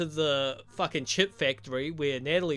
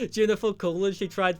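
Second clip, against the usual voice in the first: laughing; 5 semitones higher, 5 dB louder than usual. Real, not acted. Laughs recalling the flirting scene.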